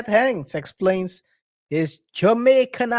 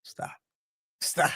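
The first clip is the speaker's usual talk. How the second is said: whispered, cutting him off; hushed stop; 3 semitones lower, 11 dB quieter than usual. Retold or imitated. Chet halting the interviewer, a whispered stop. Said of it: "he looks at him like".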